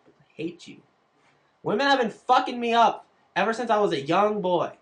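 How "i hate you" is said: whispered aside. Real, not acted. Bitter aside after joke.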